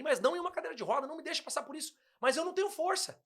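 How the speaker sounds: rising desperate plea. Retold or imitated. Retold pleading prayer to God.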